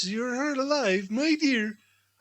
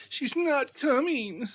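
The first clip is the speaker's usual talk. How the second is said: ordinary talk, nothing marked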